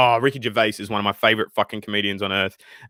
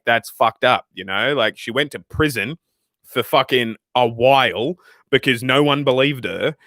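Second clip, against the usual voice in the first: usual speaking voice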